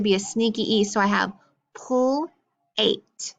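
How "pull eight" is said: "pull" for the pl blend, pause, then "eight"; sounding out. Shown as a demonstration. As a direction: pull and eight as separate chunks before blending. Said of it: "I don't have to sound the rest of it out".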